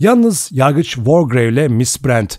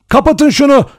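loud, much higher desperate cry; frantic scream; 14 semitones higher, 6 dB louder than usual. A narrator's reading. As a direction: beg them to stop it. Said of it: "diye haykırdı Vera". (çaresizce haykırış)

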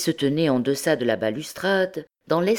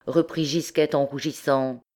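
ordinary delivery, nothing marked

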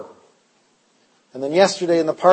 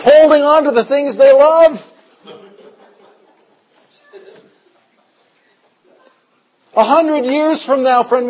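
loud, driving sermon voice; fiery preaching; 7 semitones higher, 6 dB louder than usual. Acted. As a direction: preach it hard to the congregation. Preaching at full force.

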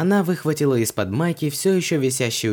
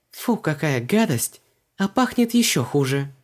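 high, recoiling from a stink; disgusted; 5 semitones higher than usual. A narrator's reading. Heroine sniffing the rat bile, disgusted. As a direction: draw out the revulsion.

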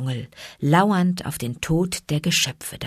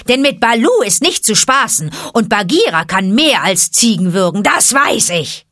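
loud, higher scornful bird voice; mocking vulture; 5 semitones higher, 7 dB louder than usual. Vulture scorning the monkeys, loud and higher.

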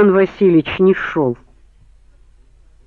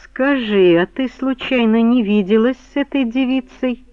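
higher, careful polite question; politer; 6 semitones higher than usual. A narrator's reading. Man probing a woman about a girl, suddenly polite. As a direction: check yourself and ask politely. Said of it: "заговорил куда вежливее".

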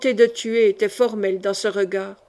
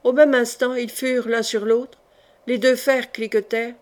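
plain reading voice

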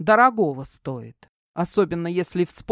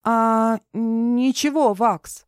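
flustered; 6 semitones higher, 4 dB louder, much slower than usual. A narrator's reading. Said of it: "Вид у неё был взбудораженный".

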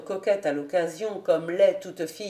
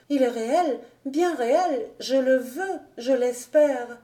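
put-on higher-pitched, mildly insistent young woman; understated firm insistence, pitched up; 4 semitones higher than usual. A narrator's reading. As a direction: press each short phrase, slowing on the last. Alix cuts in, insisting.

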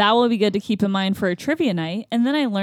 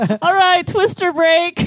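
high, loud playful call-out; jokingly hollered announcement; mock announcement; 9 semitones higher, 4 dB louder, slower than usual. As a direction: call a pretend time-out to the group. Pretends to announce break on course.

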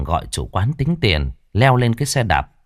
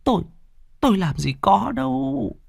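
high, tearful stammering denial; old mother whimpers to her son; whimpering; 12 semitones higher than usual. A narrator's reading. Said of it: "mếu máo". (mếu máo, nghẹn ngào)

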